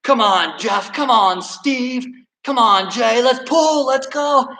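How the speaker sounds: high acted rallying call; yelling names. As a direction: cheer the pullers on, pitched well up. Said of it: "he starts yelling more names".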